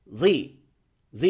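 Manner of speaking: "The" as a short, falling thee. Shown as a demonstration. Teacher models the vowel change, short thee. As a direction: cut the ee of "The" short. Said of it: "Y es corto".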